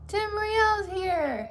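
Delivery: high, loud drawn-out greeting; big singsong welcome; excited announcement. Real, not acted. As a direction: call out the arrival, stretching it slowly. Announces someone's arrival in chat.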